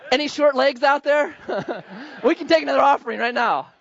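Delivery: laughing. Acted. Joking offer to congregation.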